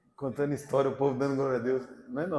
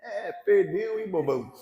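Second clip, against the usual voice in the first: laughing, teasing jeer; mock taunt; 3 semitones higher than usual. Preacher jeering at the outwitted predator on TV, mock taunt. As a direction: gloat playfully with a chuckle. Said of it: "eu faço assim".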